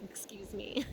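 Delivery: softly choked up, real tears; tearful apology. Real, not acted. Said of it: "and I am crying".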